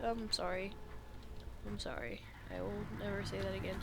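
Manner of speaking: quiet embarrassed apology; sheepish apology. Real, not acted. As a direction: mumble it, ashamed of the joke. Embarrassed apology after joke.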